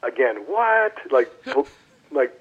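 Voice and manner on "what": sharp high mock disbelief; a retold jolt, pitched up high; stunned what. Rookie reacting to coach's Hall of Fame joke, mock-stunned. Teammate says he needs 2999 more hits.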